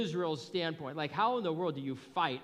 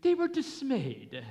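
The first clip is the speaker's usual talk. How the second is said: higher, louder mock British reading; mock British accent; 10 semitones higher, 5 dB louder than usual. Shown as a demonstration. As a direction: say it like a prim British narrator. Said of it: "It sounds quite British".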